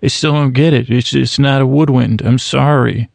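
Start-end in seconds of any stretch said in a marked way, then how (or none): none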